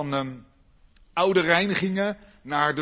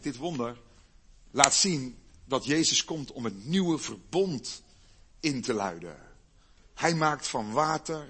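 ordinary delivery

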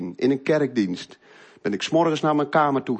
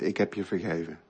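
much softer, hushed forgiveness from the wife; soft forgiving words; 8 semitones lower, 10 dB quieter than usual. Retold or imitated. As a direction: say it tenderly, barely above a breath. Wife forgiving him, crying.

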